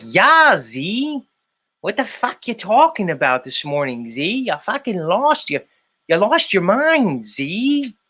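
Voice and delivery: high put-on Chicago voice, hammed up; mock Chicago accent. Host imitating friend's Chicago accent scolding him. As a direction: ham up a Chicago woman scolding, pitched high. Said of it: "She's like".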